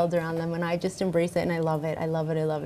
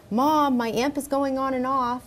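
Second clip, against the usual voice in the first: higher-pitched imitation of a son calling out; mock call down to mom; calling from upstairs; 7 semitones higher than usual. Son upstairs complaining to mom about his amp, mock calling out. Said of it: "he'll be upstairs going".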